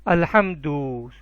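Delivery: الحمدو read slowly, final "du" as a clear "oo"; slow model reading. Shown as a demonstration. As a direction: draw out الحمدو with a rounded ending. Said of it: "کیا لکھا ہے میں نے؟". (الحمدو آہستہ، آخر میں واضح او)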